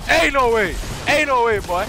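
high, shouted protest; real shout at the game; loud disbelief. Real, not acted. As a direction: yell it twice in outraged disbelief.

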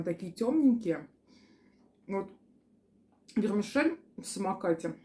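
talking between bites; chewing while speaking; mouth partly full. Real, not acted. Seen 2: bite taken at -2.0; closed-lip chewing early, then clearer speech.

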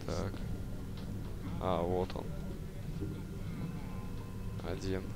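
ordinary talk

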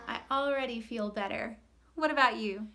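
ordinary delivery

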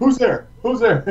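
high, loud mock challenge; mock tough guy. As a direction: bark it like a guard. Acting out tactical hockey stick.

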